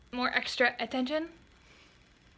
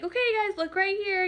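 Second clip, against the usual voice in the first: put-on higher, calling the kids' attention; bright call to kids; 6 semitones higher than usual. Photographer calling toddlers to look at her lens, higher and coaxing. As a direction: coax the kids to look at the camera.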